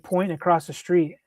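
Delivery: normal talking voice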